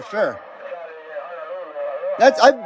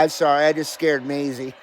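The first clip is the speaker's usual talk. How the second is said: ordinary talk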